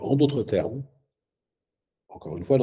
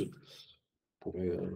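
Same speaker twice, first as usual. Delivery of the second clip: words spoken on an in-breath; talking while inhaling; 3 semitones lower, 12 dB quieter, much slower than usual. Shown as a demonstration. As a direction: speak while breathing in through the nose. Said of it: "je suis obligé d'interrompre mon flux de parole".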